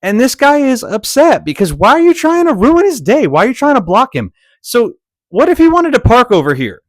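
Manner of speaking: high, loud, outraged questions; exasperated rant. Acted. Ranting at the driver trying to block him, indignant. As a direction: demand it, pitch up, indignant.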